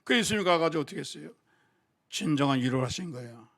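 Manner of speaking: ordinary talk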